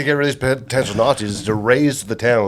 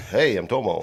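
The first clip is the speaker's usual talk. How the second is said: lightly voiced landlord impression; soft, dropping catchphrase in a hint of another voice; Tomo; 4 semitones lower, 6 dB quieter than usual. Tenant doing the landlord's greeting, lightly as Tomo. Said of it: "my landlord, Tomo".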